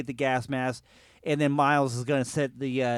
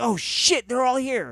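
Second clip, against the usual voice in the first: alarmed quote; 8 semitones higher, slower than usual. Retold or imitated. Acting out movie character's alarm.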